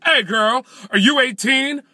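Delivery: loud, high street voice. Retold or imitated.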